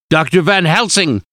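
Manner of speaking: a doctor shouting at his friend in outrage; shouted. A narrator's reading. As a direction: cry his name out in disbelief. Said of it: "I shouted".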